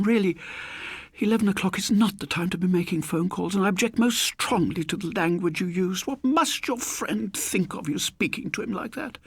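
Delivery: high, fussy spinster scolding; genteel elderly lady, mildly cross; prim old lady. Acted. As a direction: tut at a naughty man. Old lady lectures on phone call.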